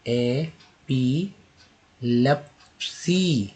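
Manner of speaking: Epilepsy drawn out very slowly; slow syllable demo. Shown as a demonstration. Teacher slowly modelling epilepsy. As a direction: stretch epilepsy for learners. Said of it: "next देखिए".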